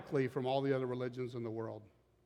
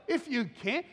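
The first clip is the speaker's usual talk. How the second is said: mock incredulous; 9 semitones higher than usual. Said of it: "Don't you love that?".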